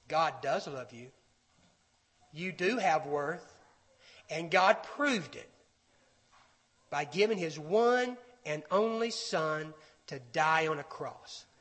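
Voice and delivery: low, soft reassurance; voice of truth. Acted. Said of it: "The voice of the truth says".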